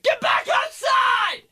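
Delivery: high, slow mock yell of a mom. Mom yelling at her kid for watching TV, high mock yell. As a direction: belt it like an exasperated mother. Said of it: "my mom was like".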